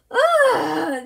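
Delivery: high loud shriek of fright; startled scream. Acted. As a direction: scream at a mouse. Woman sees mice.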